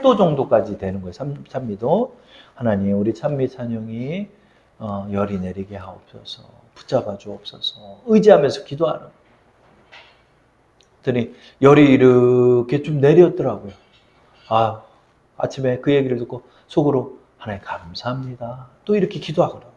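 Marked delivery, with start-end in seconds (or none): 2.5-8.0 put-on quiet, hushed plea for the children; hushed prayer. Parent praying over two sick children, hushed. 17.4-18.7 very soft, hushed thanks; inner thanks. Retold or imitated. Parent thanking God inwardly after the fever drops, hushed.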